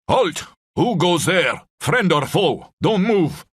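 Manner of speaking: loud, high Scottish challenge shouted from the trees; a Scottish sentry yelling halt at unseen men. A narrator's reading. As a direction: bark the challenge in a heavy Scottish accent. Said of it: "a deep growling British voice with a heavy Scottish accent".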